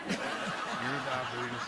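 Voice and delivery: slow, drawn-out Brimley catchphrase; laughing slow mock Brimley; mock Brimley line. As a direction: stretch it out like the old ad. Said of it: "Brimley catchphrase bit".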